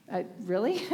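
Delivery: laughing disbelief. Retold or imitated. Laughing at the nun news.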